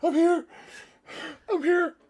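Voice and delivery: high thin feeble reply; faint weak voice. Pretend. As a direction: answer as if barely conscious. Cohost playing faint after being called.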